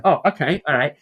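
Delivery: high, loud comic acquiescence; mock acceptance. Brother taking his sister's verdict on his accent, mock acceptance. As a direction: pipe up loudly, playing the corrected sibling.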